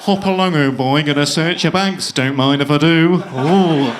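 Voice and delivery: put-on English security guard; English accent. Guard about to search a traveller's bags, hammed-up English accent. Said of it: "I'll just make him English".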